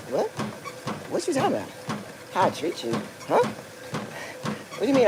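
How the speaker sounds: softly incredulous, pitch leaping up on the questions; hushed, bewildered questioning; baffled. Retold or imitated. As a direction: retell a taken-aback, puzzled reply. Retold baffled reply to friend's complaint.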